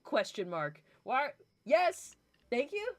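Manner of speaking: normal talking voice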